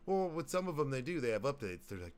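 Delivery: ordinary talk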